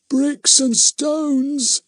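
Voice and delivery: put-on very high, slow, drawn-out tired old voice; sad weary old man. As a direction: creak it out like a worn-out old tramp. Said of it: "in a sad, weary old man voice".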